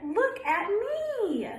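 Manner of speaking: high and slow, showing off; duckling shows off to Mama, high and slow; proud duckling. Acted. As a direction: look at me, stretched out high. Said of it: "she cried".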